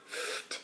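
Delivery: a soft unvoiced puff, ft; breathy ft sound. A narrator's reading. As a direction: whisper the ft as air. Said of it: "say ft".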